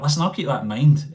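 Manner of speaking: usual speaking voice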